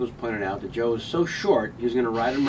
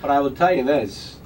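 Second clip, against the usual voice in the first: deadpan Walken cadence; Christopher Walken impression; 2 semitones higher, 3 dB louder than usual. Continues Walken bit.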